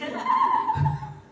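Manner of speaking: very high laugh; squealing real laugh. Real, not acted. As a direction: burst into a high-pitched laugh. Laughs after bracelet story.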